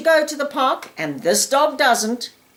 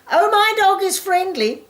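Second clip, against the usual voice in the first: much higher, breezy mock owner voice; mock owner excuse; 11 semitones higher than usual. Trainer mocking an owner whose dog leaps on others, airy and much higher. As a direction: parody the careless owner brushing it off.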